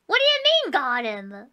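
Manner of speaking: ordinary delivery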